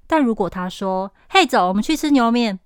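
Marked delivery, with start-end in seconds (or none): 1.3-2.6 fast, louder, Taiwanese-style 嘿，走，我们去吃牛肉面 with 牛肉面 slurred; breezy local Taiwanese invite; 台湾腔随口说; Taiwanese casual speech. Retold or imitated. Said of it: "他好像台湾人哦".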